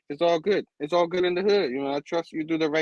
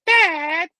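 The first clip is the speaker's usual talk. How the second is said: loud, high mock outcry; protesting daughter; 11 semitones higher, 8 dB louder than usual. Bride objects to Dad's remark, high loud protest. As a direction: cut Dad off with a high yelp.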